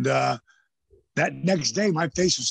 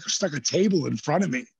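usual speaking voice

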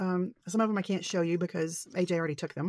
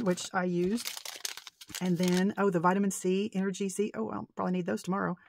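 normal talking voice

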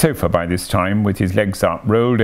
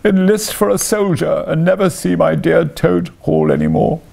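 high-pitched, hammed-up tearful wail; sobbing; 9 semitones higher than usual. A narrator's reading. Toad bidding farewell to Toad Hall, acted sobbing. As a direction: lament like all is lost, voice pitched way up. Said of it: "sobbed the Toad, crying into the sofa cushions".

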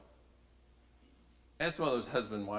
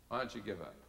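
quieter, falling question; soft repeat; 3 semitones lower, 6 dB quieter, faster than usual. Retold or imitated. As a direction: let it drop gently the second time. Same question repeated softly.

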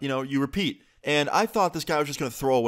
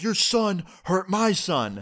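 tags 1: bitter accusation; 7 semitones higher, slower than usual. Retold or imitated. Angry father confronting the mom.

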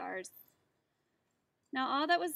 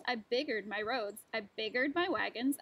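usual speaking voice

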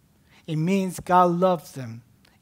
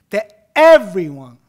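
loud, slow punch on "everyone"; stress on everyone; 4 semitones higher, 17 dB louder than usual. Real, not acted. Preacher pointing out the key word, heavy stress on "everyone". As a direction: hit "everyone" hard, drawing out its first syllable. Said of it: "I'm emphasizing the word here".

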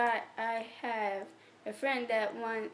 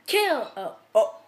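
hiccuping while talking; hiccups; 8 dB louder than usual. Real, not acted. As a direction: talk through hiccups. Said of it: "not the hiccups again".

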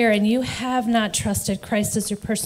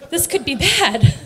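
nervous laugh; 8 semitones higher, 5 dB louder than usual. Real, not acted. Nervous laugh at remembered fear.

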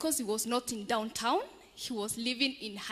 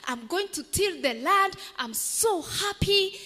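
excited; 6 semitones higher, 4 dB louder than usual. Acted. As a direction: gush with joy. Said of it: "The beggar was so excited".

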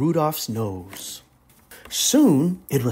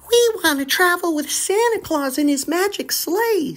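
much higher doll voice; little doll voice; 14 semitones higher than usual. Acted. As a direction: plead sweetly like a toy doll. Misfit doll speaks.

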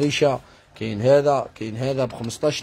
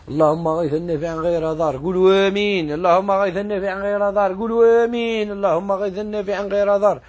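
higher, rising chant-like supplication for rain; real prayer, voice lifted on each amen; fervent prayer; 6 semitones higher than usual. Real, not acted. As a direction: lead the crowd in a rising invocation. Leads viewers in prayer for rain.

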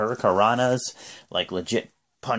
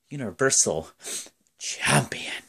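hushed, dramatic whisper; 5 dB quieter than usual. Retold or imitated. Announcing Lesnar's title, hushed whisper.

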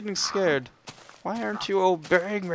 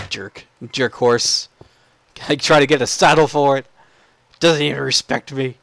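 ordinary delivery

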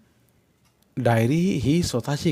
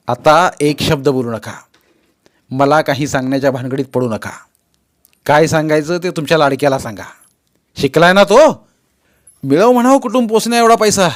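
angry son; 4 semitones higher, 8 dB louder than usual. Acted. Son cuts off father angrily.

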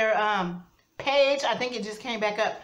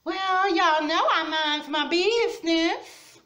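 imitating Southern accent; 8 semitones higher, 4 dB louder than usual. Vlogger quoting a YouTuber's catchphrase in a mock Southern accent. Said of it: "I love her Southern accent".

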